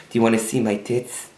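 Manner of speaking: soft, mock airhead come-on; Tanya. Airhead propositioning him in the car, softly imitated. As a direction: imitate a dumb club girl, hushed. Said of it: "she talked like this".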